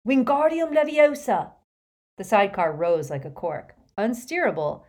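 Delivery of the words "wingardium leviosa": high, loud desperate incantation; shouted spell. Acted. Harry saving the falling sidecar, loud desperate shout. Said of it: "Harry pointed his wand ... and shouted".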